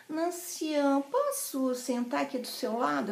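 soft, lilting imitated Rio accent; carioca accent. Friend asks to sit beside her, carioca accent. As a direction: mimic a polite carioca request. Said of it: "ela é carioca".

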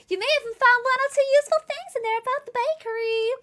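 high put-on character voice; perky high-pitched game character.

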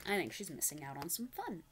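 plain reading voice

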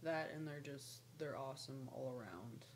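ordinary talk, nothing marked

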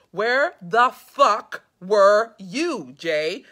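loud, slow, word-by-word fury; emphatic angry shout. A narrator's reading. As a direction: hammer each word out loudly at Jay. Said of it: "there's four explanation points".

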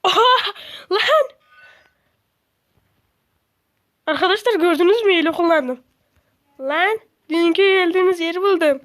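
loud, high thrilled exclaiming; real gamer excitement; excited. Real, not acted. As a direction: shout the news to your viewers. Excited gaming discovery.

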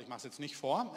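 usual speaking voice

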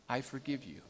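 whispered-soft forgiveness fading out. Acted. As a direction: let "I forgive you" trail away to a near hush. Pastor embraces dying man.

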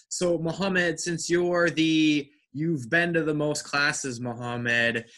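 usual speaking voice

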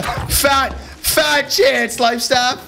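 ordinary delivery

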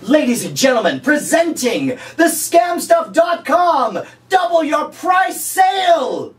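high, slow, shouted sale announcement; announcer hype. Pretend. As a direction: boom it out like a game show host.

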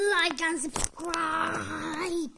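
slow, loud squawking like and subscribe; screeched outro. Acted. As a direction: shriek it like a parrot. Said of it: "call me nice".

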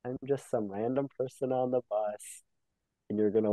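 ordinary talk, nothing marked

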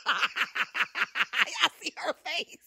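laughing. Real, not acted. Mom cracks up at daughter's face.

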